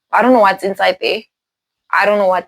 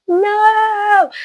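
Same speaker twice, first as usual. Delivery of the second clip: high, drawn-out wail; acted-out panicky cry; panicked refusal; 13 semitones higher than usual. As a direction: imitate a frantic, high-pitched refusal. Wife refuses the vampires, panicking.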